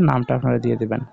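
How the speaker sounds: ordinary delivery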